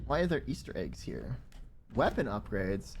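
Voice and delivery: usual speaking voice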